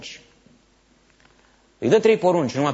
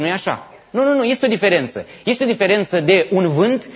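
ordinary delivery

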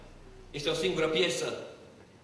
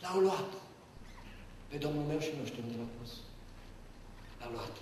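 soft, broken lament, much softer; grieving Mary; 6 semitones lower, 11 dB quieter than usual. Acted. Mary at the tomb, weeping for her Lord. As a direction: voice sinking with grief.

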